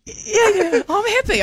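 very high-pitched, hammed-up reassurance; imitating their own jittery insistence; over-eager insistence. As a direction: squeak out a too-keen mock assurance. Acts out protesting that they're happy.

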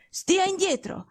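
sharp, high, imperious order to stay back; a commanding invalid warning his friend off; curt command. Acted. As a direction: snap him away from the bed. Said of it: "tono secco e imperioso".

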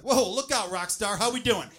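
loud, high, excited whoops; hyped cheer. Retold or imitated. Man cheering his own morning load, loud excited whoops. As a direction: cheer it on like a rock star. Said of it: "It was like".

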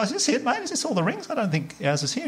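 high, rising, worried asking; anxious high question. Retold or imitated. Husband asking the shop guy about the ring, worried and high. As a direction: pitch up, pleading for it to be there. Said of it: "I say to the guy".

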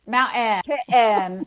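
Mountain and Kitten with the T caught in the throat; glottal T. Shown as a demonstration. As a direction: cut the T off in a glottal stop. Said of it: "the glottalization of T".

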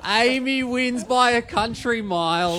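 excited play-by-play call of a sprint; race commentary. Acted.

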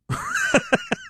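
short high burst of laughter. Real, not acted. Host laughing at his own question to listeners, high laugh. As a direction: laugh at your own jab.

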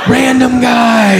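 high, slow, drawn-out exclamation; alarmed realisation. Acted. Comic suddenly remembers the caller, drawn-out alarm. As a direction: stretch it out in mock horror.

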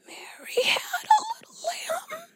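tiny, squeaky near-crying recital; imitated stage fright, very high and soft; can't find voice. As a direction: whimper the line, almost voiceless. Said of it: "I couldn't find my voice".

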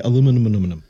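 aluminum run together with extra num syllables; tangled aluminum. Retold or imitated. Imitating people who trip over aluminum, extra syllables. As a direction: stumble quickly through aluminum, repeating its ending. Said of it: "some people like say".

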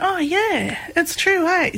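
high, bright dawning "oh yeah"; a kid's light bulb moment, voiced high; retold realization. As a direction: realize it aloud with a lift. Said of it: "a bit of a light bulb moment".

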